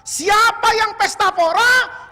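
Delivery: a jeering cry flung across the hall; mocking shout. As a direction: bellow the taunt out slowly. Preacher voicing a mocking taunt.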